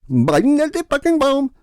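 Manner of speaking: loud mock Middle Eastern accent; mocking his accent. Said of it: "mocking his accent".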